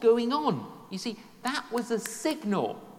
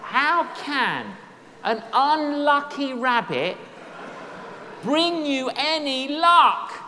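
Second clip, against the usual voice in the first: rising, louder, slower disbelief; incredulous loud; 5 semitones higher, 7 dB louder, slower than usual. Real, not acted. Preacher to the congregation, loud incredulous challenge. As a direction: drag it out, how could that ever work. Preacher mocks rabbit's foot luck.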